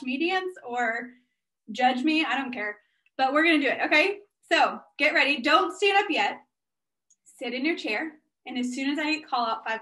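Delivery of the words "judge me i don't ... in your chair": ordinary talk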